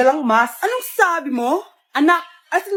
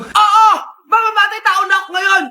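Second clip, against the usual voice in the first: loud, wild threatening yell; furious shout; 3 semitones higher, 6 dB louder than usual. Acted. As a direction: let the rage burst out. Mother explodes at neighbor. (galit na sigaw ng nanay sa kapitbahay)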